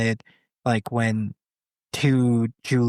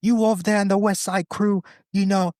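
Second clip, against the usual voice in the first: put-on a lightly done London street accent, pitched higher; British gang accent; 9 semitones higher than usual. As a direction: mimic a British gang member calling out. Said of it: "two rival British gangs".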